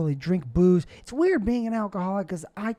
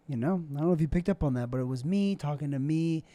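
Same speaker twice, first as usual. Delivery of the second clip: ordinary talk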